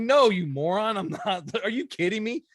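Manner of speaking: high, laughing retold tease; amused mock insult through laughter; busting out laughing. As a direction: crack up while breaking the prank to your kid. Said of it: "I just bust out laughing".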